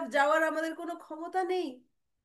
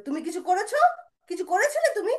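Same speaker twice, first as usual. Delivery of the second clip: insistent questioning; 7 semitones higher, 7 dB louder than usual. Acted. Brother questions the complaining child.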